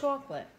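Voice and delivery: "chocolate" as CHAWK-lit; New York pronunciation. Shown as a demonstration. Telling how we say it, New York pronunciation. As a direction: round the first vowel of "chocolate" into "aw". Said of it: "We say chocolate".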